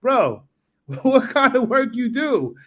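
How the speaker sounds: slow, drawn-out whoa with a laugh; amazed disbelief. Retold or imitated. Retelling his reaction to the young viewer, amazed and laughing. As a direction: marvel at the kid's lifestyle, laughing.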